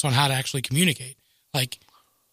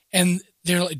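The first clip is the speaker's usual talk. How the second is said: usual speaking voice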